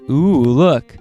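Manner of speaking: ordinary delivery, nothing marked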